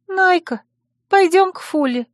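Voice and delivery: high-pitched, plaintive begging; plaintive plea. A narrator's reading. Worried friend asks the heroine to see Fula, plaintively. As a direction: beg her in a small high voice. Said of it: "жалобно попросила она".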